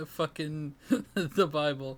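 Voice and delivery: laughing through the words. Real, not acted. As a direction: giggle while saying it. Laughing at her own story.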